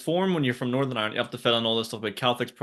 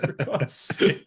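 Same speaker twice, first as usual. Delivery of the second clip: mock raging; much slower than usual. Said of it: "I was raging".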